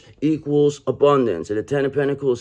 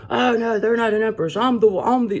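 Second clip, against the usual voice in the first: mocking high voice; 11 semitones higher, 3 dB louder than usual. Mocking imitation of Queen of Swords.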